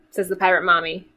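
ordinary delivery

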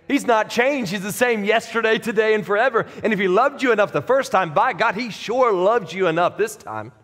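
high, ringing proclamation of faith; fiery preaching. Acted. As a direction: preach it out to the whole room. Pastor preaching at full force.

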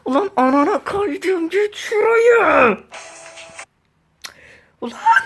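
screaming rage. Real, not acted. Raging gamer slips again, screaming at the screen. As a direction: scream at the game as you slip off.

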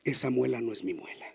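low soft chant; soft mantra. Retold or imitated. Hypnotist giving the wife her soothing mantra. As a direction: murmur the mantra.

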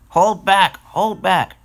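high, loud protest; calling out. Acted. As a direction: shout it twice as stubborn train cars. Cars refusing to come along.

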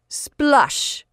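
high, loud scornful scoff. Acted. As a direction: scoff at her. Marjorie's scoffing sound.

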